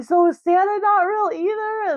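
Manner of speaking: put-on high, childlike squeaky question; hopeful little boy. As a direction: ask it like a wide-eyed kid who suspects the truth. Son asks about Santa.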